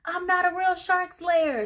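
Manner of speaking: high-pitched, pleading outburst; mock desperation. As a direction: imitate someone bursting under mounting fear. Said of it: "fear of losing her mounting and building up".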